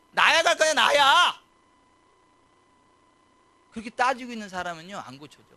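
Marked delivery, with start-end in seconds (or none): 0.1-1.4 high, loud demanding put-on voice; mock insistent whine. Preacher mocking a believer who demands healing first, high and loud.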